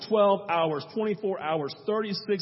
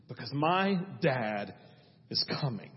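tender; 5 semitones lower, 4 dB quieter than usual. Acted. Child's words, hushed and tender.

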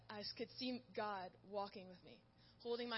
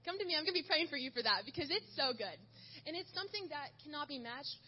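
rising, louder, eager plea to come forward; excited appeal; 4 semitones higher, 6 dB louder than usual. Real, not acted. Speaker urges the youth group to come for prayer, eager and rising. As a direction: pour it out, inviting everyone up.

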